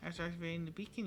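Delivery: ordinary talk, nothing marked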